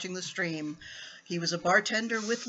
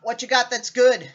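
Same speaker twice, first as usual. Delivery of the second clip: put-on Texan accent; 3 semitones higher, 9 dB louder than usual. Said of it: "in a Texan accent".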